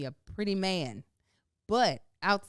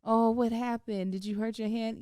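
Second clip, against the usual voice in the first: soothing mom; 5 semitones higher than usual. Retold or imitated. Mother comforting son Amar, gently cooing. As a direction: coo over the hurt hand. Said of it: "If I'm like".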